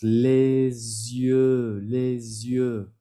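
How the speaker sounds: Les yeux with a Z link, lez-yeux; French liaison. Shown as a demonstration. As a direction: sound the Z into yeux. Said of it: "Lo mismo con los ojos".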